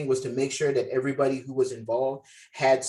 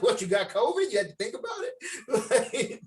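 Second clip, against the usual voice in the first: high, laughing "what, you got covid?"; laughing mock disbelief; 8 semitones higher than usual. Director laughing at a student's hesitation. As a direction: giggle through the question in mock shock. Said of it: "I was like".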